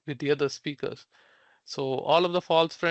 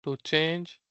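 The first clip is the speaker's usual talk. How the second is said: usual speaking voice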